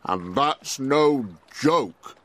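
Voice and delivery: slow frog voice; slow, sad frog voice; Froggle. A narrator's reading. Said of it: "Froggle said".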